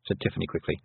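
usual speaking voice

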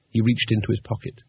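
ordinary delivery

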